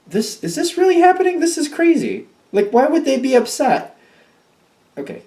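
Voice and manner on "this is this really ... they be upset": high-pitched, indignant disbelief; incredulous outburst. Real, not acted. Reader reacting to the helper being blamed, high-pitched incredulity. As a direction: pitch it way up, unable to believe it.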